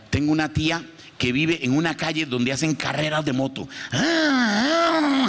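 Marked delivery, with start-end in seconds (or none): 3.9-5.3 motor roar. Retold or imitated. Comedian imitating street motorcycle racing, high engine whine.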